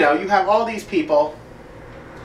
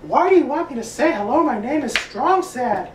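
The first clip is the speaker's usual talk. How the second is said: high, whiny put-upon voice; Strong Sad voice; 9 semitones higher than usual. Shown as a demonstration. Vlogger showing his Strong Sad impression, high and whiny. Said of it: "I can also do strong set as in".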